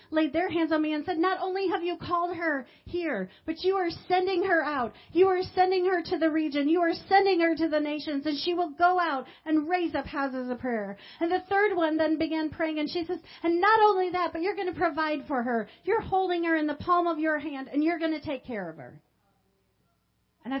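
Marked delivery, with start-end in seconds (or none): 1.2-11.0 fervent prayer. Retold or imitated. Intercessor praying over pastor. 13.4-19.0 fervent prayer. Retold or imitated. Third intercessor praying over her.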